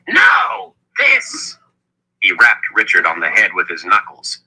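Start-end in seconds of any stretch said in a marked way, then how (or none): 0.1-1.6 very high, emphatic outburst; sharp interruption. A narrator's reading. Zed cutting Kalen off as she objects, sharp interruption. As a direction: cut in sharply, pitch leaping up. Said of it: "Zed cut her off".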